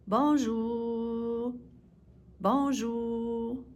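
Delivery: Bonjour with a long, held "jour"; stretched-out jour. Shown as a demonstration. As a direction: draw out the end of Bonjour for learners. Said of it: "stretch out the jour".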